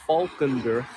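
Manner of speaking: "Valkenburg" with an F sound at the start; "Valkenburg" spoken like a Dutch local; proper Dutch pronunciation. Shown as a demonstration. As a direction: demonstrate "Valkenburg" the Dutch way, "Falkenburg". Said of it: "the proper Dutch pronunciation".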